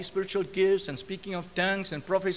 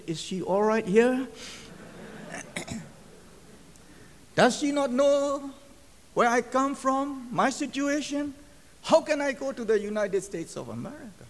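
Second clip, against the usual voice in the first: incredulous wondering; 6 semitones higher than usual. Retold or imitated. Preacher doubting he could ever reach America, incredulous. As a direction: wonder aloud in disbelief, drawing the words out. Retells his disbelieving inner questions.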